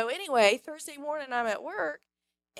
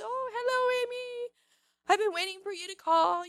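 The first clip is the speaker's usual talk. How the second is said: high-pitched imitated French accent; Veronica; 9 semitones higher, 4 dB louder than usual. French woman greets awaited caller, imitated high French accent. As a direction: mimic an eager French woman, voice lifted high. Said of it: "in her very French voice".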